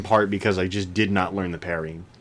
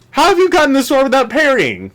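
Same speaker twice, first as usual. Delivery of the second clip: mocking question; 8 dB louder than usual. Quoting the game's taunt.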